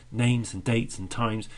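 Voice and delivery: the woman leaning on "names", "dates" and "times"; stressing each noun. Acted. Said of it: "She's stressing those".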